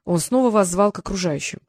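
ordinary delivery, nothing marked

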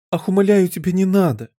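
high-pitched frantic pleading; desperate cry. A narrator's reading. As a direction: beg her desperately to stop. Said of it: "закричала королева в отчаянии". (отчаянная мольба, высоким голосом)

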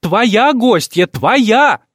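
high, loud, slow shriek. Acted. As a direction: keep shrieking it. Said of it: "завизжала Николетта".

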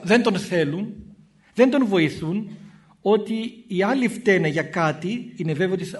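ordinary talk

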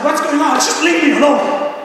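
high-pitched mock exasperation; acted irritated outburst. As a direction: imitate someone testily pushing people away. Acts out snapping when anxious.

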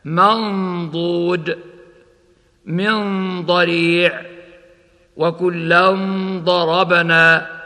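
slow منضود, the ن held as a hum before ض; ikhfa before ض. Shown as a demonstration. Teacher demonstrating ikhfa before ض, slow recitation. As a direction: recite وكلا ضربنا with the tanween hidden and held. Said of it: "هكذا".

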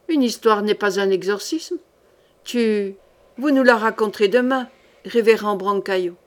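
ordinary delivery, nothing marked

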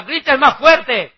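loud, high mocking taunt, drawn out; Elijah. Elijah mocking the prophets of Baal, loud taunt. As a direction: shout the jeer slowly at them. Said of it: "Elías dice que les decía".

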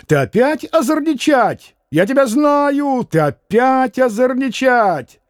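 закричал мещанин; shouting. Acted. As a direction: yell at the boy, dragging it out. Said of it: "закричал мещанин".

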